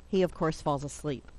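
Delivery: usual speaking voice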